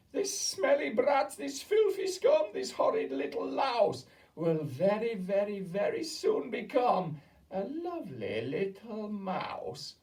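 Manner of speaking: high, loud, slow gloating witch; shouting. A narrator's reading. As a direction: spit out each insult gleefully. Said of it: "she shouted".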